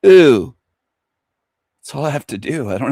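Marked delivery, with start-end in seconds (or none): 0.0-0.6 loud drawn-out taunting ooh; mocking ooh; teasing ooh. Shown as a demonstration. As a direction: taunt them with one syllable. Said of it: "one syllable".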